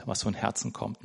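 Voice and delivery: ordinary talk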